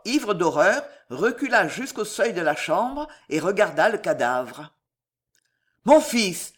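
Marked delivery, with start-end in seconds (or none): 5.8-6.5 higher, louder exclamation of alarm; sudden cry. A narrator's reading. Father suddenly thinks of his son, crying out. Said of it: "s'écria-t-il tout à coup".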